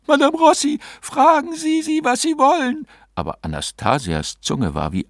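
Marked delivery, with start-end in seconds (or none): 0.1-2.9 very high, agitated woman's voice; urgent pleading. A narrator's reading. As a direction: implore the medium, high and insistent. Client begs medium to ask spirits.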